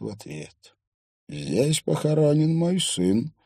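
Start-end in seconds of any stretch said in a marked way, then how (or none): 1.3-3.3 lightly voiced hoarse, slow old man; a touch of rasp, drawn out and pitched up. A narrator's reading. Old man at his son's grave, lightly hoarse voice. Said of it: "сказал он глубоким, хриплым голосом".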